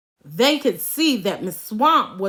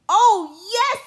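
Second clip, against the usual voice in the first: high, loud cheer of joy; delighted cry; 9 semitones higher, 4 dB louder than usual. A narrator's reading. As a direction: cheer it out eagerly. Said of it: "cried the kids".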